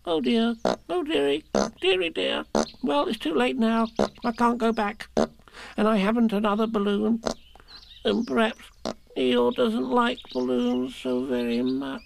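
very high little fretting voice; Piglet, dismayed. A narrator's reading. Piglet fretting over Eeyore's lost balloon, high and dismayed. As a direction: fret in a small, high voice.

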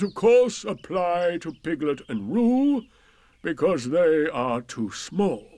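slow, grand gloomy donkey voice; pompous Eeyore. Acted. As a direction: make a solemn speech, gloomy. Eeyore's speech.

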